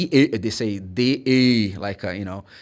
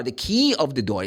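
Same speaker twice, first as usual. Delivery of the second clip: normal talking voice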